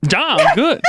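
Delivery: bright, high and delighted; joyful reply in Swedish; happy. Real, not acted. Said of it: "you seem really happy saying it".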